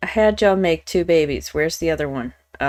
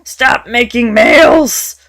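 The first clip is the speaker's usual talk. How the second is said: frustrated yell, loud and higher; angry shout; 6 semitones higher, 10 dB louder than usual. Real, not acted. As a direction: bark out in real frustration at the game. Frustrated gamer yelling at game.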